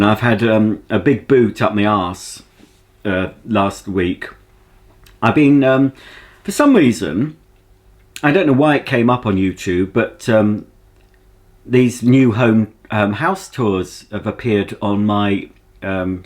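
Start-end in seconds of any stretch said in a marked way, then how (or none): none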